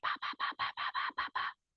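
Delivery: unvoiced whispered pa pa pa. Shown as a demonstration. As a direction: breathe the syllables out as a whisper. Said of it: "whisper anything you wanna whisper".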